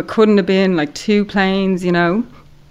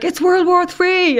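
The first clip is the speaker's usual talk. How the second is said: high, slow, stunned declaration; pitched-up dread, dragging each word; a guard announcing doom; alarmed guard; 11 semitones higher than usual. Retold or imitated.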